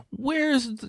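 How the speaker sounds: very high mock-needy voice; complaining man-child. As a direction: imitate helpless searching, high-pitched.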